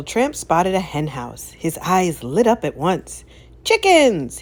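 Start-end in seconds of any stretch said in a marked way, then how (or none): 3.6-4.4 high, loud gleeful shout; Chickens! cried out high and loud; excited cry. A narrator's reading. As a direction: yell it like you've spotted treats. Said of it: "he cried".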